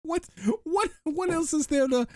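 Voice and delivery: very high, halting squeal; mock wail. Co-host still joking about Mills, high mock wail. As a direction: stammer it out in a hammed-up high voice.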